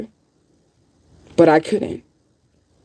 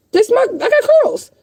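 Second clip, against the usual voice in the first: emphatic pitch jump. Real, not acted. Showing her hair to viewers.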